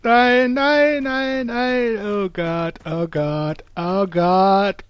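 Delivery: high, loud panicked shrieks; panicked screaming. Real, not acted. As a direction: scream in real terror. Panics at aliens in game.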